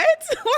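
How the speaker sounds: high-pitched burst of laughter; high loud laugh. Real, not acted. Laughs at her own shock.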